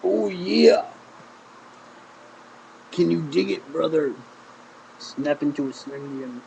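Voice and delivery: hammed-up wrestler imitation; Macho Man. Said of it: "That's a crappy Macho impression".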